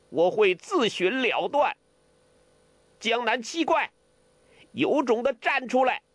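high-pitched, harsh challenge to fight; stern fierce shout. A narrator's reading. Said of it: "他厉声喝道". (厉声喝道)

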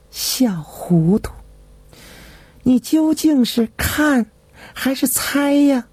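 whispered teasing scold, then rising high; fond scolding. A narrator's reading. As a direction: smile while you scold him. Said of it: "李太后笑眯眯骂了一句". (笑眯眯地嗔骂)

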